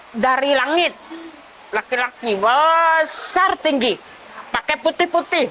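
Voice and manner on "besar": "besar" drawn out slowly to show huge size; drawn-out emphasis. Retold or imitated. Telling of the giant from heaven, "besar" slowly stretched. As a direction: stretch the first syllable of "besar" long. Describing a gigantic man from heaven.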